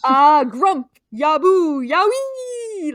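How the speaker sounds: loud, high Yowie call ending on a long held cry. Acted. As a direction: belt out a creature's rallying call, rising high at the end. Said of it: "Yowie impression".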